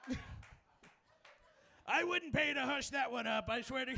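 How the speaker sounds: a light Trump voice, laughing through it; Trump impression. Comic imitating Trump bragging, laughing through it. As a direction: brag like Trump while cracking up. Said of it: "Trump would be like".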